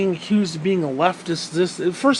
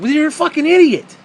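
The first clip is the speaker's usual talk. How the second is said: higher, louder angry snap; angry retort; 7 semitones higher, 6 dB louder than usual. Real, not acted. Comedian ranting at conservative Christians, loud angry snap. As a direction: blast him with it.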